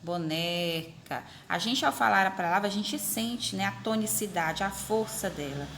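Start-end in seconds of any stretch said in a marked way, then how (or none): 0.0-1.2 "Boneca" with a long, drawn-out "ne"; slow "Boneca", lingering on "ne"; stress on NE. Shown as a demonstration. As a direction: stretch the middle syllable of "Boneca". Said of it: "Nós sabemos que é o né".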